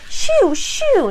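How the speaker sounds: put-on high little-girl shoo; a three-year-old shooing the dog off her pillow. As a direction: shoo the dog away like a toddler. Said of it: "my sister looks over and says".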